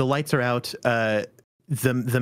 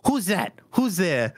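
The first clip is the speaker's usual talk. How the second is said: higher, startled call into the dark; startled servants; 6 semitones higher, slower than usual. Acted. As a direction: servants peering out, startled, calling who is there. GM voices startled NPCs.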